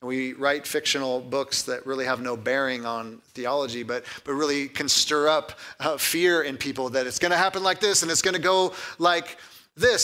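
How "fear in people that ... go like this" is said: ordinary talk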